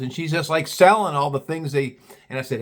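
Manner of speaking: normal talking voice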